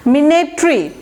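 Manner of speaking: মিনেটরি as "mi-ne-tree"; short "mi" opening মিনেটরি, then "ne-tree"; correct pronunciation. Shown as a demonstration. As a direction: end মিনেটরি on "tree", not "tory". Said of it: "মাইনেটরি বলবেন না".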